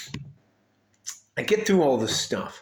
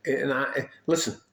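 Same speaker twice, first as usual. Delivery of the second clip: usual speaking voice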